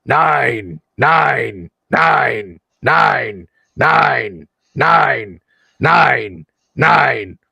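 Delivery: slow rasping robot voice, stuck looping; metallic voice filter. Acted. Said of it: "a rasping metallic voice says".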